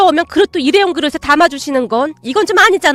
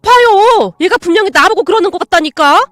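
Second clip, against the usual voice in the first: loud indignant aunt; 5 semitones higher, 7 dB louder than usual. Acted. Aunt complains loudly.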